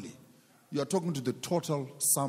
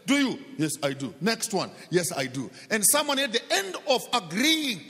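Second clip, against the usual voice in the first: loud, high, driving sermon voice; rising pulpit fervour; fiery preaching; 4 semitones higher, 4 dB louder than usual. Acted. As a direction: preach it hard to the congregation. Preaching at full force.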